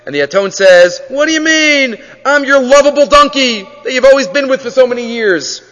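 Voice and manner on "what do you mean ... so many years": high, wounded appeal; pleading protest. Acted. Donkey answering Bilam's threat to kill it, pleading. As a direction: plead your case in a raised pitch.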